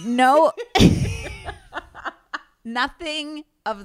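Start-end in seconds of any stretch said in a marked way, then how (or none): 0.6-2.1 breathy laugh. Real, not acted. Laughing at booty to booty.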